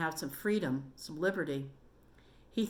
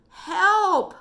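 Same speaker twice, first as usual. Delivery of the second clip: scream for help; 13 semitones higher, 11 dB louder than usual. Acted. Trapped boy calling for rescuers.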